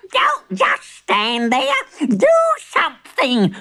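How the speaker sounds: high, drawn-out frantic granny; hammed-up panicked granny; shrieking. A narrator's reading. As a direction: demand help in a high shriek. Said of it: "she shrieked".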